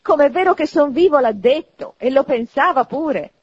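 loud, high exclamation; crying out. A narrator's reading. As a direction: proclaim it at the top of your voice. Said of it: "gridava il nipote di Scrooge".

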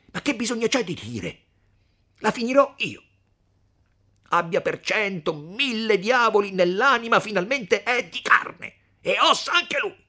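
loud, high furious ranting; raging threat. Acted. Renzo raging about the tyrant, loud fury. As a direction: spit out the threat at full voice.

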